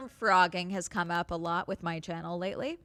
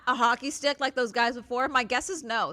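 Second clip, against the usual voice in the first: ordinary delivery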